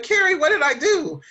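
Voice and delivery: high, loud mock panic cry for help. Co-host calling for help in mock panic. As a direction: call out to Carrie like something broke.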